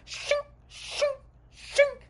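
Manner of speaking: high, sharp imitated blade scrape; knife-sharpening noise. Sister sharpens her knife as she approaches, metallic sound effect. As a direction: make the ring of a blade being sharpened. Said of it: "You can hear her sharpening".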